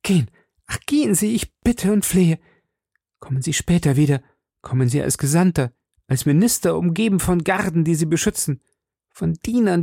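high-pitched, urgent begging; pleading queen. A narrator's reading. Queen begs the duke to return later as envoy, pleading.

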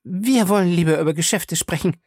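high-pitched, mildly cross woman; annoyed. A narrator's reading. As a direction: cut him off with a touch of irritation. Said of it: "sagte Faye ärgerlich".

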